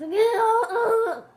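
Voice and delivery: real loud choking cough; loud coughing fit. Real, not acted. Choking on a laugh.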